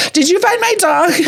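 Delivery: lightly voiced, high teary owner asking; relieved owner. Dog owner on the phone to the finder, high and teary. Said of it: "she's, like, almost crying".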